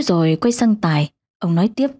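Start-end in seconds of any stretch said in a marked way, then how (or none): none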